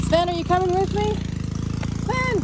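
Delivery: calling out. Real, not acted. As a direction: call the dog from afar. Calling the dog.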